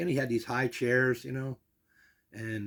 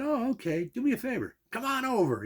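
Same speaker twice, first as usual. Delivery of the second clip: higher, eager mimicked Bob; eager invitation; 12 semitones higher, 4 dB louder than usual. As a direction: coax him over, keen. Bob invites him over eagerly.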